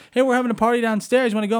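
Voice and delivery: high-pitched, eager-sounding invitation; mock party invite. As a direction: pitch it up like a chummy host. Acted party invitation from a neighbor.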